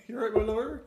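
a rustic fisherman, West Country; West Country accent. Retold or imitated. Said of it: "little West Country fisherman".

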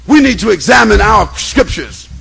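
loud preacher, building; preaching at full force. Acted. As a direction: swell into the call. Sermon rises again.

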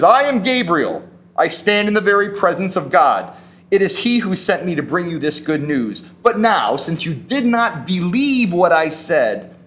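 indignant angel. Acted. Gabriel rebuking doubting Zechariah, indignantly loud. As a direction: draw yourself up, offended authority. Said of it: "like, indignant, insulted even".

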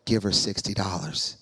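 a deep, calm divine order; God's command. Retold or imitated. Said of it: "the Lord".

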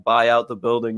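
ordinary talk

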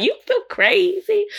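high, slow mock [name] voice; imitating [name]. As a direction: drawl it out in a put-on high Miami voice. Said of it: "she'd be like".

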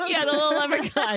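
amused, high laughing reply. Real, not acted. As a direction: giggle through the line. Joking about a leprechaun child.

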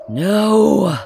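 loud, high roar of horror. A narrator's reading. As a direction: bellow the word out. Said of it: "I roared".

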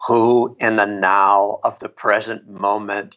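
low, slow imitation of a teacher; measured, deep guru impression. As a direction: intone it like a solemn spiritual teacher. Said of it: "in that teacher voice".

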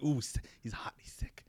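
unvoiced whisper of alarm; mock hushed reaction. Man touching another man's head, hushed mock concern. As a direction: whisper it like a retold aside.

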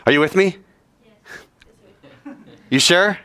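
usual speaking voice

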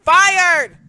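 loud emphatic shout; shouted agreement. Real, not acted. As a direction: bark it out. Echoing partner loudly.

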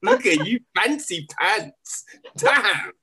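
high, laughing, teasing envy; mock jealous. Real, not acted. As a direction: tease her about her trip, high-pitched. Jealous teasing about Morocco trip.

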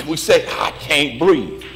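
preacher working up to an impassioned peak; building preaching. Real, not acted. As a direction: start restrained, climb in pitch and intensity with each refrain. Sermon litany building to shout.

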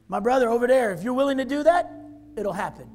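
shouted high preaching climax; full-force preaching peak, shouting. Real, not acted. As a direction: cry it out to the brother over there.

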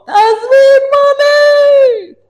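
very high, long drawn-out screech; screaming. Retold or imitated. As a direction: shriek the demand as the raving wife. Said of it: "צרחות". (צרחה ארוכה)

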